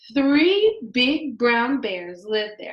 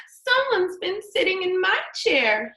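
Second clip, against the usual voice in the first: put-on high little baby bear voice, much higher; baby bear crying out; 9 semitones higher, 3 dB louder than usual. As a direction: wail it as the tiny bear. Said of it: "he cried".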